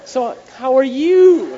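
high, drawn-out mock gushing greeting; sing-song show-off greeting; gushing bride. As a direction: stretch it out, high and loud, like a giddy bride. Acting a bride showing off ring.

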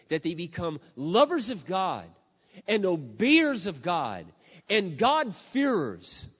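loud, slow, rising sermon climax; preaching at full force. Acted. Preacher urging mothers, loud and slow. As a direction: drive it home from the pulpit.

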